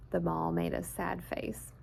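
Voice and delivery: hushed narration; a soft storyteller hush; whisper. A narrator's reading. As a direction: whisper the sad moment.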